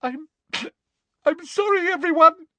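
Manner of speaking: high, broken tearful apology; fake sobbing. A narrator's reading. Hermione pretending to break down, sobbing apology. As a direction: sob out an apology, theatrically. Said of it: "Hermione was sobbing desperately".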